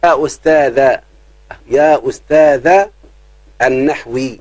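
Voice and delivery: stretched call with a rising end; teacher stretching the word to show a vocative; slow drawn-out call. Shown as a demonstration. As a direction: drawl يا استاذ slowly, rising at the end. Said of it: "مضاف اهو، شوف".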